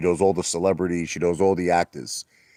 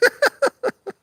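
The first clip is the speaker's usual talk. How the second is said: high squeal laugh. Real, not acted. Laughing at own joke.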